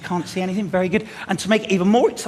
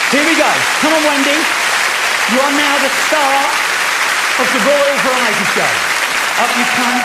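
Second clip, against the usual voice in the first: hyped-up welcome; 5 semitones higher, 5 dB louder than usual. Real, not acted. Bringing Wendy on stage.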